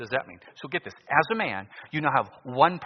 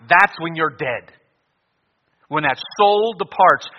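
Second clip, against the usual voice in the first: rising, louder sermon voice; preaching; 6 semitones higher, 10 dB louder than usual. Acted. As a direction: press the point home.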